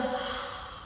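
long unvoiced sigh of awe; sigh of wonder. Shown as a demonstration. Teacher showing students wonder, breathing out. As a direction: let the breath out in amazement. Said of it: "breathe out with a".

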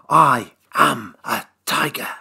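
ordinary delivery, nothing marked

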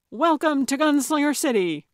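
high, loud showman's welcome; excessive exuberance. A narrator's reading. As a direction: greet them with over-the-top gusto. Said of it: "with excessive exuberance".

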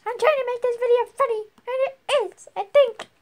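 high, fast, defensive; high excited. Real, not acted. Defending video to friend.

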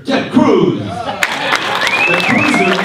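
loud, high crowd-rousing introduction; rousing announcement. Real, not acted. Host hyping a favourite senator to the room, loud rousing announcement. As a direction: belt out the name, drawing out the nickname.

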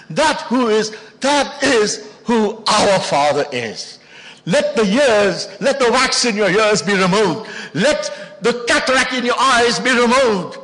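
loud, high, drawn-out exhortation; preaching at full force. Real, not acted. Preacher calling for blocked ears and eyes to clear, full-force exhortation.

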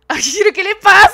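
loud, high, flustered squeal; flustered yell at the game character; excited outcry. Real, not acted. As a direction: cry it out all worked up.

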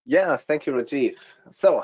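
ordinary delivery

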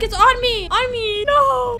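high-pitched alarmed cries; panicked yelp. Pretend. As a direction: yelp as the monster closes in. Monster chasing the player.

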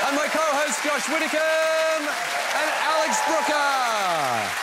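big, slow, loud guest introductions; announcer. Acted. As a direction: announce each name like a showman.

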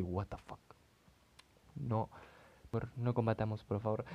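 normal talking voice

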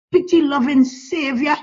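ordinary delivery

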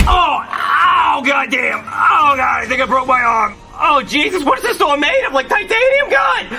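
hurt yelling. Acted. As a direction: yell out in pain from a busted arm. Cop hurt by door.

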